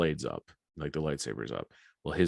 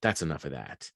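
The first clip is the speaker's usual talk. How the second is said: cold menace; 3 semitones lower, 4 dB quieter than usual. Retold or imitated. Victim act drops, menace.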